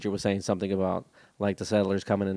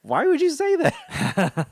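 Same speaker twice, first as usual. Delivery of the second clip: very high, loud, drawn-out mock child; baffled kid voice; 7 dB louder, much slower than usual. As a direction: imitate your son's squeaky bewilderment. Imitates baffled son in put-on voice.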